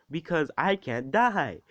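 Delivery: loud, high, drawn-out mock boast; hammed-up, gloating punchline; joking boast. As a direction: crow it slowly, playing it for laughs. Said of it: "he's just gonna joke about everything".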